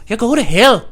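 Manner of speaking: high, loud bad Irish priest; bad Irish accent. Priest damning a kid, bad Irish accent. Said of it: "I can't do an Irish accent".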